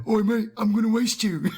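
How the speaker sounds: high-pitched mock Cockney thug. First Cyclops with the gun threatening someone, mock Cockney. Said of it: "It's Cockney now".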